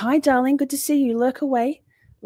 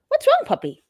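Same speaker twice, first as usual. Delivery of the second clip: much higher, cooing question to the dog; pet talk. Real, not acted. Owner asking her dog what's wrong, high cooing. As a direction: coo at the pup.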